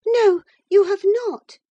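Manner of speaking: high, loud contradiction; infatuated defiance. A narrator's reading. Infatuated girl contradicts her sister-in-law, high defiance. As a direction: fling it back, high and insistent. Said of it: "said the infatuated girl".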